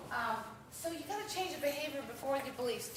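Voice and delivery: normal talking voice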